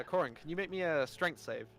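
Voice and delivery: usual speaking voice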